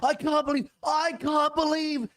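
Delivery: put-on high-pitched whining online teen; whiny terminally online teen. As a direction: wail like a devastated nineteen-year-old. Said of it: "all the nineteen-year-old terminally online people".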